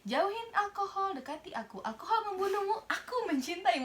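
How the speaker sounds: high, lovesick sweet-talk; lovesick flirt. Pretend. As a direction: croon it like a smitten lover. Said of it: "Bucin ya, orang bucin ya". (rayuan bucin)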